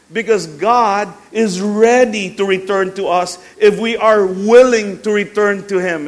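loud, high-pitched sermon proclamation; ringing emphatic preacher delivery; full-force preaching. Acted. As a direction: proclaim it to the whole church with power.

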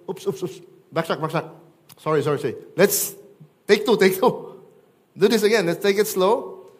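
loud, high, flustered backtracking; hammed-up apology, scrambling to take it back; preacher mocking a Jesus who backpedals; mock backpedaling.

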